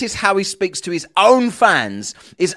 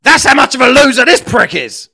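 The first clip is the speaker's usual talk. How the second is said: loud, raised-pitch contempt; scornful outburst; 4 semitones higher, 8 dB louder than usual. Real, not acted. Summing up the easily triggered debater, scornful outburst. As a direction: spit out the verdict loudly.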